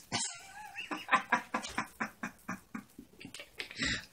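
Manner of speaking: high squeaky laughing fit, trailing into wheezes; helpless, breathless cackle; long wheezing laugh. Real, not acted. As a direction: lose it completely over a silly line. Long laugh at melodramatic line.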